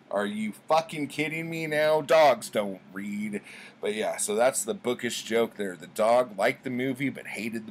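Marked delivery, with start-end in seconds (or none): 0.1-3.4 loud incredulous retort; mock exasperation. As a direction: snap back at the absurd claim. Incredulous reply to punchline.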